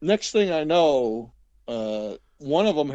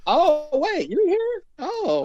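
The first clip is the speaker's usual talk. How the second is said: much higher mock surprise; mock surprised visitor; 5 dB louder, much slower than usual. Imitating visitors who only stopped by because they were nearby. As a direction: squeak it out, high and surprised.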